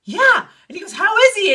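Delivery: high, loud exclamation; retold amazed excitement. Screenwriter discovering a shared acquaintance, loud and excited. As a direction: jump in loudly with delighted recognition. Acting out his amazed reply.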